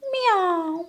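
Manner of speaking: high drawn-out meow; high mock meow; cat meow. Cat meows.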